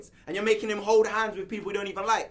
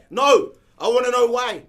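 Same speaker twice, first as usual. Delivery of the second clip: louder, hammering out why; loud insistence; 3 semitones higher, 10 dB louder than usual. Real, not acted. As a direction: refuse to let it go. Shouting at Ross over football.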